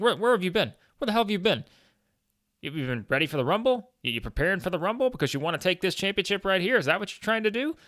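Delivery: hammed-up imitation of an irritated tag partner grilling him; unhappy, demanding.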